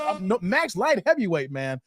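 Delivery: ordinary talk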